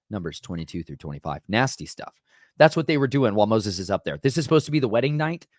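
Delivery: ordinary delivery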